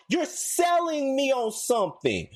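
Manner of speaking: normal talking voice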